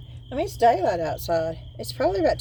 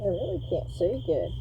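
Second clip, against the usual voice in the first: talking through a respirator mask; muffled behind a mask; 7 dB quieter than usual. Real, not acted. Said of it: "in this mask".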